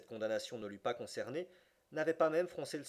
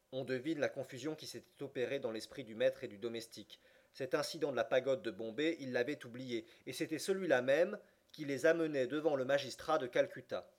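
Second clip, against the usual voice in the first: ordinary delivery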